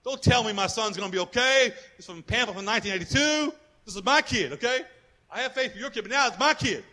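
upset father arguing with God; retold angry protest. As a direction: push back hard at God. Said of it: "I was screaming at God".

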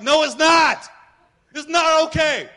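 desperate father shouting no; retold scream. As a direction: yell it out as if at God. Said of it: "I was screaming at God".

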